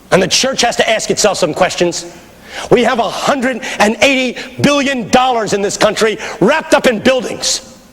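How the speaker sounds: high, ringing sermon delivery; full-force preaching. Real, not acted. Preacher challenging a congregation on sacrifice, forceful high preaching. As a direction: preach it hard, pitch held high.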